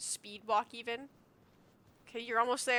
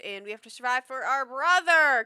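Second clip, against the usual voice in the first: loud rallying cry for brother; rallying shout; 3 semitones higher, 9 dB louder than usual. Real, not acted. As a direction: rouse everyone, loud and firm.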